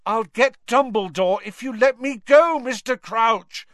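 Harry pleading with Crouch; urgent teenage boy. Acted. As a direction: plead to be let go.

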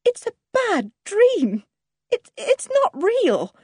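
high, slow, faltering disbelief; stunned, high-pitched protest; distraught denial. A narrator's reading. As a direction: stammer it out high, refusing to believe. Wife refuses to believe the news.

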